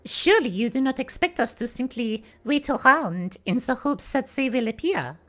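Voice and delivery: formal put-on French-accented character voice; Celeste. Voice actor protesting having to wait, in a French-accented character voice. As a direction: object politely in a careful French-accented voice. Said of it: "Celeste is also faking the French accent".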